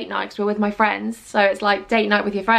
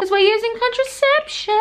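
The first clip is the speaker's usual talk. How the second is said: much higher prim chirp; mock prim punchline; 11 semitones higher than usual. Joking to viewers about babies, high prim chirp. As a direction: deliver the joke primly.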